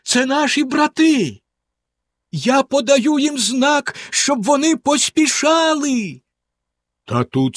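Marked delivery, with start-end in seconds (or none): 0.0-6.2 much higher, slow joyful cry; exclaiming. A narrator's reading. Anna exclaims from the tower that the brothers come, high and joyful. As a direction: cry out with relief. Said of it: "вигукнула вона".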